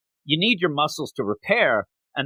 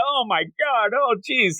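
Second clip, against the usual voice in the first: mock crying; 9 semitones higher, slower than usual. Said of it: "it's the crying, just baby Midoriya".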